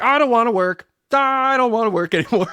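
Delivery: high, loud mock whine; whiny complaint. To himself, a month into unemployment, whining in mock despair. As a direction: whine it like a fed-up kid, half laughing. Whining about not wanting work.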